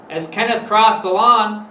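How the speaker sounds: ordinary delivery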